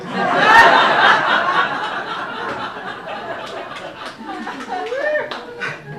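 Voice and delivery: long breathless laugh; big laugh. Real, not acted. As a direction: let the laugh roll out. Laughs after punchline.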